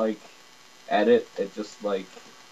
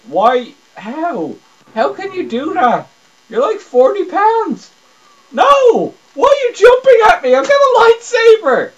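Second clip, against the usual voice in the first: much higher, loud indignant cries; outraged yelling; 12 dB louder than usual. Real, not acted. Gamer yelling at the opponent jumping at him, outraged. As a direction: protest at the unfair jump.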